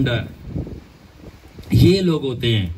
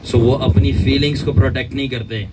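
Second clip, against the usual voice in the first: ordinary delivery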